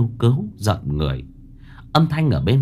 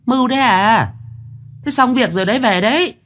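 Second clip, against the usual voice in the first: high hag voice; 13 semitones higher than usual. Acted. Mão answering the voice at the door, high harsh hag. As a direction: call out like the harsh old mistress.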